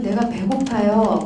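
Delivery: normal talking voice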